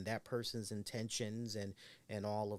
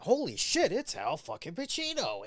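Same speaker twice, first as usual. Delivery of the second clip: starstruck shout; 11 semitones higher, 7 dB louder than usual. Retold or imitated. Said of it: "you're like".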